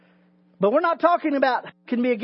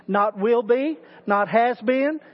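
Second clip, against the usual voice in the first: normal talking voice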